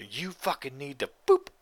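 loud, angry retold snap; angry yell. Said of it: "He's just like".

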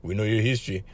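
low, quiet uncle warning; low-voiced uncle, matter-of-fact; disapproving local uncle. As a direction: drop low and warn him like a local uncle. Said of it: "you get the uncles over there".

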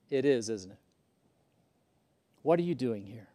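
ordinary delivery

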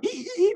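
high squeaky "ee" imitating a bike; mock bicycle squeak sound. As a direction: squeak like a rusty pedal.

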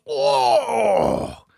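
loud, high, drawn-out howl; pained cry. Retold or imitated. Co-host acting out the film character's injury, drawn-out pained cry. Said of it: "and he goes".

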